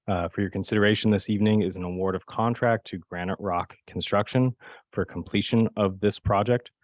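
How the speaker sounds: ordinary delivery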